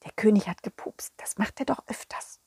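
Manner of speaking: whispered aside to the dog; hushed whispered aside. Acted. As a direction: whisper it so the king won't hear. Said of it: "Ja, Balli".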